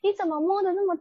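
high, indignant outburst; outraged. Real, not acted. Recounting the aunt touching her father, outraged and high. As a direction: pitch shoots up in disbelief and anger. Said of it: "你知道我有多气吗".

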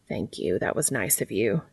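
very low, soft character voice; quiet gratitude. Acted. Cass thanks Dean for warming him, low and quiet. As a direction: drop deep and soft, sincerely thankful.

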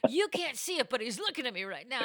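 ordinary delivery

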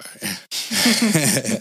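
self-conscious laugh after admitting tears. Real, not acted. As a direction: chuckle it off. Admits he cried, apologises.

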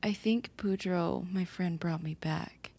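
usual speaking voice

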